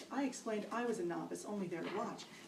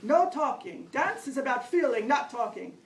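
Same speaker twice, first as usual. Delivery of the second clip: loud, higher instructor yelling; yelled orders; 4 semitones higher, 11 dB louder than usual. Retold or imitated. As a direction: shout it at a dance class. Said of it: "instructor was yelling out".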